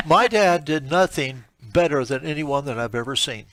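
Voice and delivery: ordinary talk, nothing marked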